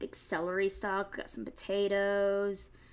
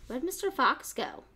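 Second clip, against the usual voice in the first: ordinary delivery, nothing marked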